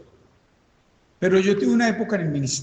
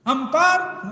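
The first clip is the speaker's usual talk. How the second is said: desperate call; 12 semitones higher, slower than usual. Acted. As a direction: call out for help, pitched way up. Llamando a amigos desde el túnel. (llamado desesperado muy agudo)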